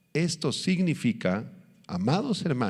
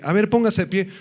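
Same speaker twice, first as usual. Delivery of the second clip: a hint of a doctor, higher pitch; lightly voiced doctor; 7 semitones higher, 4 dB louder than usual. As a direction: lightly act a doctor giving an order. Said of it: "el doctor te dice".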